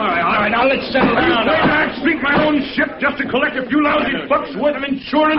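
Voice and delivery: high, rising outraged shout; the accused captain shouts at the investigator. Acted. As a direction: build into a furious challenge. Captain accused of sinking ship.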